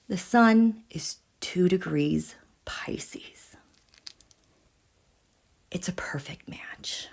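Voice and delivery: slow, hushed whisper of wonder. Real, not acted. Whispering the perfect match to her viewers, slow and hushed. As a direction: let it fall to a slow whisper.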